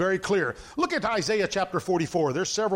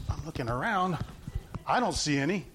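lower, understated mock searching; sarcastic; 5 semitones lower, 4 dB quieter than usual. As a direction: feign a casual look round, pitched down. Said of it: "That is sarcasm where he says".

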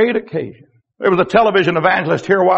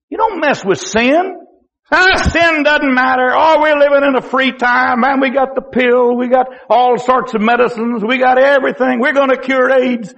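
higher, breezy scoffing voice; mock dismissive; 8 semitones higher, 5 dB louder than usual. Preacher mimicking people who shrug off sin, mock dismissive. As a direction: mimic someone waving the warning away.

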